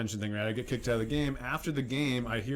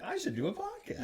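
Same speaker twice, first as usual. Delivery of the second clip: mock drunk idea; 9 semitones higher, 4 dB quieter than usual.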